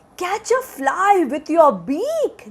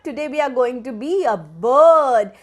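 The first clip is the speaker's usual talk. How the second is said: ordinary delivery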